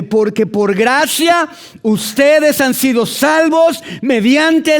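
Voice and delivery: high, loud, slow proclaimed scripture; preaching at full force. Acted. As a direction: shout the verse out to the whole church. Lee Efesios 2:8-9 a toda voz. (proclamación lenta, fuerte y aguda)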